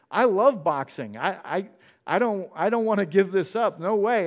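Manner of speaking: won't give up what he loves; stubborn refusal. Retold or imitated. Fiancé refuses to quit boxing, stubbornly insisting. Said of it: "he's really kinda digging his heels in".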